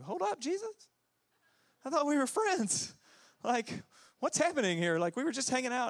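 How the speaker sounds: high, mock-hurt whine; offended disciples. Disciples protesting to Jesus, high mock hurt. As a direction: play wounded friends.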